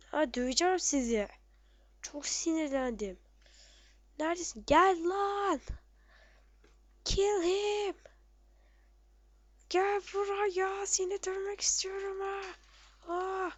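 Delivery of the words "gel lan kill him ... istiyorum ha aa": higher, slow furious taunt; angry taunt. Real, not acted. Angry player daring his enemy to come closer, furious taunt. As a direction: call the enemy out to fight.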